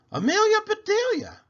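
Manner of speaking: very high, loud outraged call; shocked lady. Acted. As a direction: cry her name in shock. Mrs Rogers shocked at popcorn.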